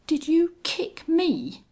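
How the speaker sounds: slow, high-pitched indignant cry; moderately loud, high shout of rage; outraged shout. A narrator's reading. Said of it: "he shouted".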